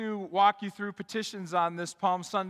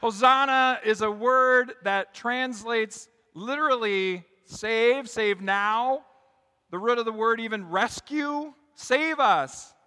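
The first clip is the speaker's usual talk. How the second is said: raised, louder preaching voice; forceful preaching; 7 semitones higher, 10 dB louder, slower than usual. Real, not acted. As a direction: proclaim it to the whole congregation with rising force.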